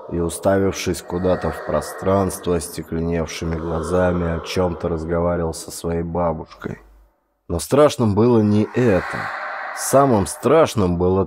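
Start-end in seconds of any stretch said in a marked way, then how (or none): none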